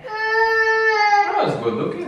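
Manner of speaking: hammed-up mock sob, high and slow; fake crying. Husband fake-crying at his wife over the new haircut, hammed-up sob. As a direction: pretend to weep, drawn out and wailing. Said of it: "wag mag-fake crying".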